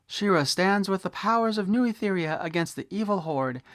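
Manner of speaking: high, grand announcer read of the box text; packaging blurb read. Acted. Reviewer reading the back of the toy box to viewers, grand announcer style. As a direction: boom it out like a cartoon trailer.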